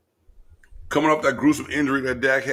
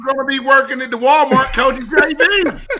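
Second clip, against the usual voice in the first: put-on high-pitched, shouted Texas accent; mock Texas accent; 10 dB louder than usual. Co-host teasing with a movie line, shouted mock Texas accent. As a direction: holler it in a hammed-up Texas accent. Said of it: "people in Texas talk like that".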